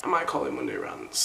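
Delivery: a low, quiet joke take on the title; deep mock voice. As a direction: mutter Monday Rants like a deep-voiced announcer.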